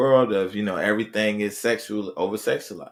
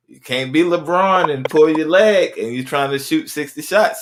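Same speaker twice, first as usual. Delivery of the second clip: louder and higher, driving the point home; emphatic loud preaching; 8 semitones higher, 10 dB louder than usual. Real, not acted. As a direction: hammer it like a coach pleading. Preaching point about LeBron, emphatic.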